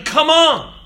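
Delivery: high, loud "Come on!"; rally the runner with a cry; shouted. Acted.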